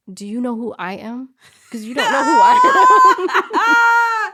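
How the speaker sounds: building from firm to shouted; defiant shout back. Retold or imitated. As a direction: voice climbing into a loud shout. Confronting doctor, voice rising.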